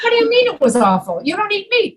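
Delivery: high, fast baffled question; mock disbelief. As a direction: protest in comic confusion. Acted comic misunderstanding.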